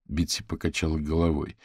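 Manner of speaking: usual speaking voice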